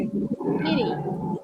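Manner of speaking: "Eating" with the T softened to a quick flap; "Eating" in an American accent, tapped T. Shown as a demonstration. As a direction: say "Eating" American-style, T as a light tap. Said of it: "puxa pro sotaque American".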